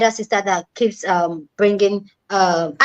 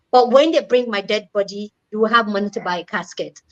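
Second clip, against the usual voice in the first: usual speaking voice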